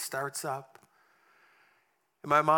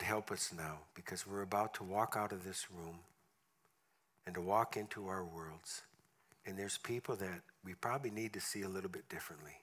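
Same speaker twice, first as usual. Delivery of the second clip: prayer; 5 semitones lower, 5 dB quieter than usual. Acted. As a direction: pray quietly over the congregation. Closing prayer.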